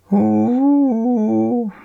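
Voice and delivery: high, loud, drawn-out hoot; calling out. A narrator's reading. Boy poking his nose out hoots, calling out. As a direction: hoot it out like a playful call.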